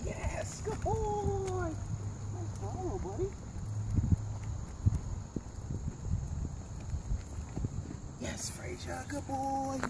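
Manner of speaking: very high, soft sing-song praise for the dog; cheering praise. Real, not acted. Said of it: "I'm gonna cheer him on".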